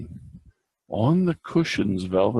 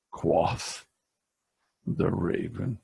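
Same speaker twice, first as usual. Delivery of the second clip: ordinary delivery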